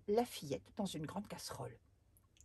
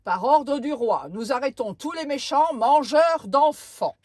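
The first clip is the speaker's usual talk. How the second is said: commanding soldier; 6 semitones higher, 20 dB louder than usual. Acted. Soldiers arrest the giant.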